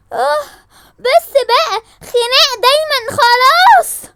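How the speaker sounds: high, loud pleading scream; desperate shout. Acted. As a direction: scream to stop the fight. Mother screams to stop fight. (صراخ عالي يوقف الخناق)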